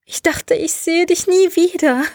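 high, acted tearful voice; sobbing. A narrator's reading. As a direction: sob it out with relief. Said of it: "schluchzte sie".

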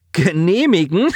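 scornful word that breaks into a high laugh; mocking laugh. A narrator's reading. Lieutenant mocking the commander's authority, scornful laugh. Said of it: "Abermals lachte Guges auf".

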